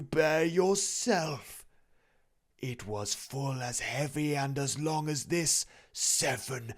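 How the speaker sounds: high, loud, slow mournful spirit voice; wailing ghost. A narrator's reading. The ghost lamenting its fate to Scrooge, high and wailing. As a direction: moan it like a doomed soul.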